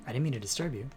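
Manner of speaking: ordinary talk, nothing marked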